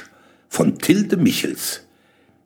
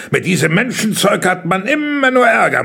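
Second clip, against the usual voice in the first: raised, grumbling complaint; impatient grumble, pitched up; 6 semitones higher, 4 dB louder than usual. A narrator's reading. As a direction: grumble about these tiresome companions. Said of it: "murrte er".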